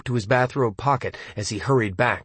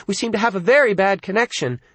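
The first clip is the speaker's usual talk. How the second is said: higher telephone operator voice; 8 semitones higher than usual. Acted. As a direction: play a polite phone operator, pitched up. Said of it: "the operator said".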